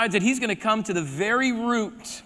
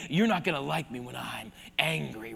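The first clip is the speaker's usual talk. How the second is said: low breathy whisper under the breath; a soft menacing whisper, barely voiced; 9 semitones lower, 8 dB quieter than usual. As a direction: whisper the threat to yourself. Moses imagined muttering a threat.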